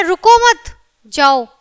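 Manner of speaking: "रुको मत" firmly joined, long break, then "जाओ"; pause after मत. Shown as a demonstration. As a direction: run रुको into मत and halt before जाओ. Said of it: "और यहाँ है".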